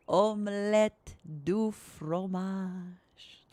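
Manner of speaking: extremely slow French accent; drawn-out, savoured French accent; soft French accent. Shown as a demonstration. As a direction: stretch every word in a hammed-up French accent. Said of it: "in a soft French accent voice".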